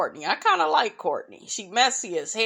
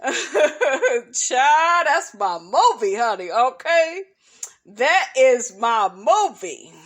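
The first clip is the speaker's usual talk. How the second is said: louder, drawn-out, emphatic praise; excited gushing; 2 semitones higher, 4 dB louder, slower than usual. Real, not acted. Raving to viewers about her favorite movie, loud and thrilled. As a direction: rave about the film.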